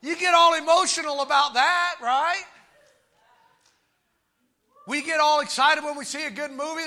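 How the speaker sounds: full-voiced, emphatic preacher; fervent preaching. Real, not acted. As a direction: drive each phrase home to the congregation.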